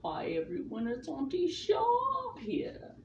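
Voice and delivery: high, slow, squeaky auntie character voice; Auntie Sharp voice. Pretend. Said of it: "You know the character".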